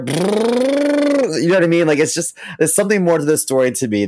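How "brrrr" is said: helicopter noise. Retold or imitated. Imitating the spoon helicopter.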